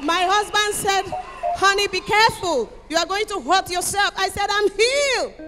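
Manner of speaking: ordinary delivery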